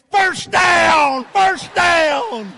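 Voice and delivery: full-throated, high first-down shout; re-enacted in-your-face cheer; retold celebratory yell. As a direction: bellow it like a rowdy football fan. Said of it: "Just like that. I did".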